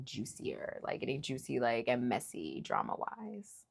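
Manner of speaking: conspiratorial whisper; hushed gossip whisper. Real, not acted. Teasing juicy drama ahead.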